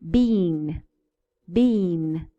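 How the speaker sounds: Bean with a long ee. Shown as a demonstration. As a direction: hold the ee in Bean. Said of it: "Let's see the difference".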